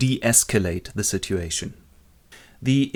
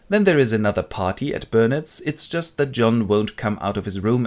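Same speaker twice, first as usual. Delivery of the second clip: usual speaking voice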